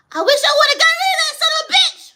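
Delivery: high, loud imitated scream of a cruel mother; mother's scream. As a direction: throw her hateful words back at full volume. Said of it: "heard your mother say".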